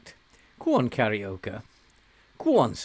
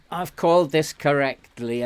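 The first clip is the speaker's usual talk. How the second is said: ordinary talk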